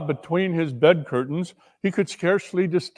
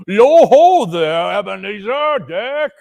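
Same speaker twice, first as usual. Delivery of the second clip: slow, louder jolly boom; 5 semitones higher, 6 dB louder than usual. A narrator's reading. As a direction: call out the apprentices heartily. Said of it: "comfortable, booming voice".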